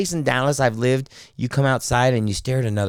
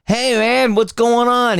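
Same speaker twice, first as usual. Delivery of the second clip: much higher, lively retold hello; hearty phone greeting; 12 semitones higher, 4 dB louder, slower than usual. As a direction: greet a buddy loudly down the phone. Acting out friend's phone greeting.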